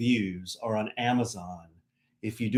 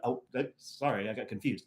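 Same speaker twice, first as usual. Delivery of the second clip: ordinary talk, nothing marked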